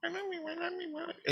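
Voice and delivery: tiny, soft, very high voice; imitated priestess. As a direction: repeat it high and faint, naggingly. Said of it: "it's driving me nuts".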